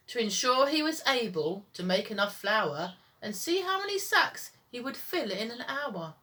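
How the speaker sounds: ordinary delivery, nothing marked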